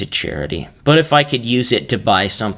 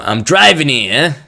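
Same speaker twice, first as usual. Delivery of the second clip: put-on louder, higher New York taxi driver accent; 6 semitones higher, 6 dB louder than usual. Taxi driver protesting in traffic, brash New York accent. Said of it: "Just like a New York taxi driver".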